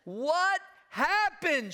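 high, loud, drawn-out question; imitated wife. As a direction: mimic the wife calling out, high and slow. Imitates wife reacting to scream.

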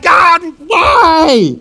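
loud high plea; anguished cry. Retold or imitated. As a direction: wail it up to heaven. Joshua prostrate crying out.

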